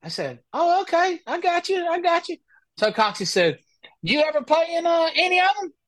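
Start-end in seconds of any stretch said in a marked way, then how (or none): 0.5-2.4 high, wide-eyed agreeing; mock naive agreement. Answering the stranger with high mock innocence. His own reply to stranger. 4.0-5.7 high, feigned curious question; mock innocent Cox. Cox baiting the stranger in a high innocent voice.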